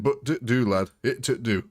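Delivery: lightly broad Yorkshire, shrugging it off; a modest Yorkshire "it'll do"; Yorkshire accent. Shown as a demonstration. Streamer admitting his Yorkshire accent is rough, mild. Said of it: "It not the best Yorkshire accent".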